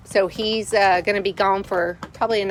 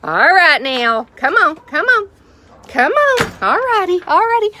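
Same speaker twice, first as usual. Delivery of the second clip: high, loud chicken call; 10 semitones higher, 7 dB louder than usual. Real, not acted. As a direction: call the hens in, rising. Calling chickens, pitch climbing.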